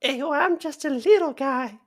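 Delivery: high, slow pitiful little guy. Acted. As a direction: drag it out in a small high voice. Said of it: "say it in your best little guy voice".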